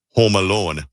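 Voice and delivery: Minnesota accent on "Home Alone"; lightly put-on Minnesota accent. Shown as a demonstration. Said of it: "say some Minnesota words".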